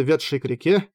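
plain reading voice

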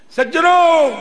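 loud, high, ringing address; calling out. Real, not acted. Preacher hailing the gentlemen of the congregation, loud call. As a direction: call out to the whole crowd.

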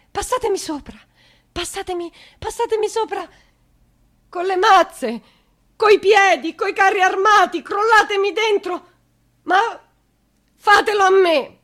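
high, loud screaming plea; desperate scream. Acted. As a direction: throw it at them with everything. Mother screams again. (grido disperato, alto e fortissimo)

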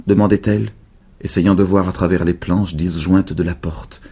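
ordinary delivery, nothing marked

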